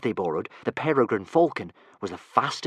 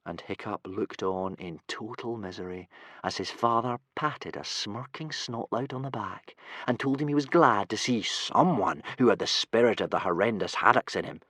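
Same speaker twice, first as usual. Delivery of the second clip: usual speaking voice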